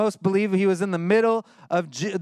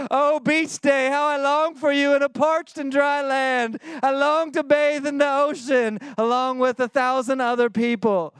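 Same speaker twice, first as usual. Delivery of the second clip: mock worshipful longing; 6 semitones higher than usual. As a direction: parody a swooning prayer to a beach day. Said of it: "It sounds ridiculous to say that".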